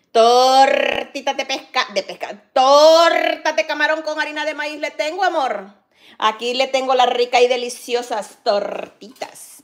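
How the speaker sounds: loud sing-song vendor call; street vendor call. Acted.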